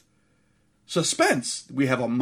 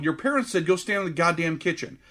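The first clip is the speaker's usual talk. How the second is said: normal talking voice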